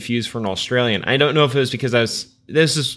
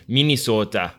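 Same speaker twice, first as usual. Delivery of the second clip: local Minnesota accent. Shown as a demonstration. Host teasing guest about how locals say it, mock Minnesota accent. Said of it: "people up there pronouncing that".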